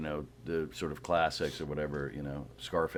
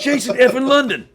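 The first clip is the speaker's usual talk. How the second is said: even louder, very high, laughing through the name; laughing shout; 15 dB louder than usual. Retold or imitated. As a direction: shout it again and crack up.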